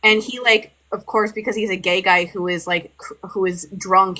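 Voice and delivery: normal talking voice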